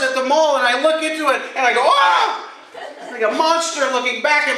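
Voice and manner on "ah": high startled shriek, laughing; a high, laughing shriek of fright; mock scream. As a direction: yelp in fake horror at the mirror. Recoiling from magnifying mirror.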